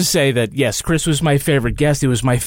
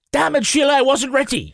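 mock Irish; 10 semitones higher than usual. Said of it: "you hear a lot of".

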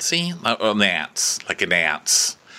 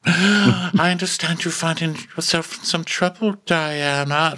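high, sugary imitation; put-on sweet condescension; mock sympathetic queen; 8 semitones higher than usual. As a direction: feign gentle concern in a pitched-up voice. Voicing queen's sugary sympathy to servant.

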